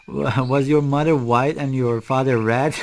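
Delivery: usual speaking voice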